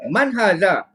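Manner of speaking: "Man haza?" asked, hitting man; question tune. Shown as a demonstration. Teacher shows students how a question sounds. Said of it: "Ni orang kata".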